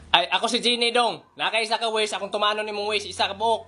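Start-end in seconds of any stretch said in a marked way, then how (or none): none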